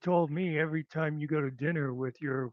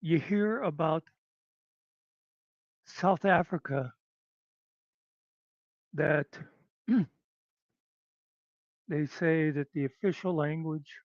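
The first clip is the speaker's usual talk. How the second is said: usual speaking voice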